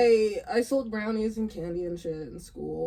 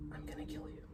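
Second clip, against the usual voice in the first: threatening whisper, no voice; cold whispered death threat; 6 semitones lower, 20 dB quieter than usual. Retold or imitated. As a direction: hiss the threat under your breath. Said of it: "It's, it's the whisper".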